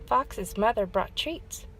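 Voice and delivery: plain reading voice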